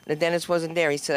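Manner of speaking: normal talking voice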